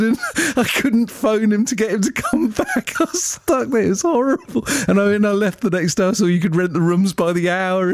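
high-pitched, real laughter through the telling; helpless laughing. Real, not acted. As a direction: tell your awful night while cracking up. Laughing over horrible night story.

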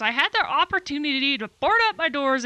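ordinary talk, nothing marked

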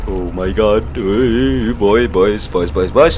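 usual speaking voice

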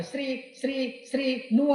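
slow, stuck repetitions of Shri before the name; imitating a stutter. Boy telling the teacher his name, stuttering. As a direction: stammer the first syllable over and over. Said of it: "నత్తి".

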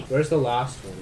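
ordinary talk

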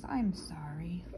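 soft unvoiced whisper of I'm sorry; whispered translation. Shown as a demonstration. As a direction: breathe the words I'm sorry.

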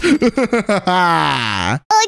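sinister villain chuckle; evil laugh. Acted. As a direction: cackle as if plotting doom. After sinister "last purchase" line.

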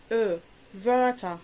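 Ö drawn out, then Wörter with a rounded ö, very slow; slow umlaut demo. Shown as a demonstration. Teacher showing students the ö sound, very slow. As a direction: stretch the Ö sound before Wörter. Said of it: "الأو أوملاوت تنطق إزاي؟".

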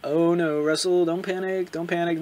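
soft, slow nervous murmur; panicked self-talk. Acted. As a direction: talk himself down quietly. Nervous character talking to himself.

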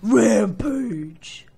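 loud victory yells; excited shout. Real, not acted. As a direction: bellow each hit. Killing zombies.

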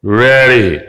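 low, drawn-out starter's cue; measured, low-pitched ready call; race starter's call. Retold or imitated. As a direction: imitate a starter holding runners at the line. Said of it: "그래서 한마디 딱 하죠".

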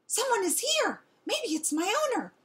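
very high, excited announcement; loud hopeful barking. A narrator's reading. Dog on porch spotting a truck, barking excitedly. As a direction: cry it out high, full of hope. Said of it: "barked loudly".

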